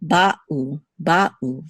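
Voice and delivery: ba'u split with a pause after ba; distinct syllables. Shown as a demonstration. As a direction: say ba'u as two separate beats, ba and u. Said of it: "So same thing with Hebrew here".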